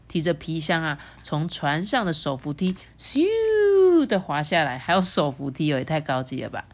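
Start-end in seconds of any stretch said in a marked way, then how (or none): 3.0-4.1 long high rising-then-falling "siu"; whoosh sound effect. A narrator's reading. Storyteller mimics sliding down the handrail, drawn-out whoosh.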